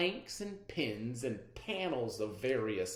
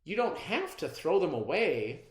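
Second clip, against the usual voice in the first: usual speaking voice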